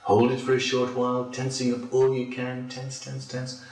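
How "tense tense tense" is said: quiet, high, rapid repeats; urging tension. Real, not acted. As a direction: press them on softly in a tight little burst. Urges tighter muscles before release.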